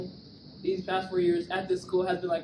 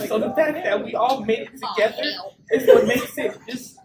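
crying; 3 semitones higher, 6 dB louder than usual. Real, not acted. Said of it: "and that's why I'm crying".

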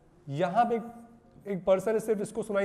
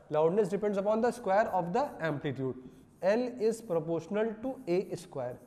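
slow dictation; much slower than usual. Shown as a demonstration. Teacher dictating the key formula.

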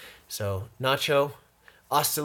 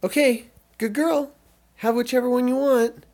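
much higher, louder sing-song praise; dog praise voice; 10 semitones higher, 9 dB louder than usual. Real, not acted. Owner praises her little dog, high cooing voice.

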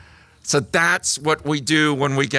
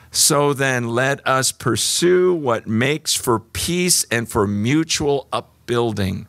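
一語一語ゆっくり強調して; slow deliberate repeat; slower than usual. Shown as a demonstration. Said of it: "repeats verse slowly".

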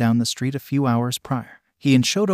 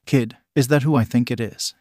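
usual speaking voice

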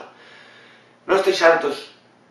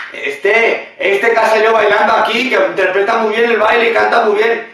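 higher, louder, impressed talent scout; a put-on casting-agent voice, higher and louder; mock casting director; 4 semitones higher, 4 dB louder than usual. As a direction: play the scout who just spotted a star. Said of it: "te ven ahí y dicen".

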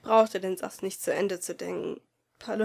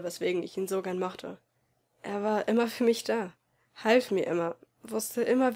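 ordinary delivery

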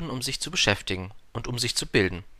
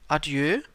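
ADIEU higher, rising as a question; fragender Abschied; 6 semitones higher than usual. A narrator's reading. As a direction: say Adieu as a hopeful second try, pitched up. Prince repeats his farewell questioningly. (fragend angehobenes Adieu)

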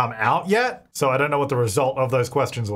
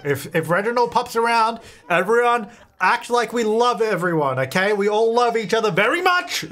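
excited instructions; 7 semitones higher than usual. Real, not acted. Rallying the sim family.

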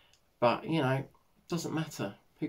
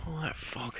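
whispering; 3 semitones higher, 10 dB quieter, slower than usual. Real, not acted. Early morning, hushed remark on fog.

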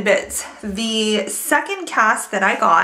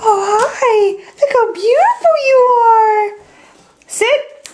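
very high, louder puppy voice; 12 semitones higher, 6 dB louder, slower than usual. Real, not acted. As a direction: melt over the dog, high. Gushing over the dog.

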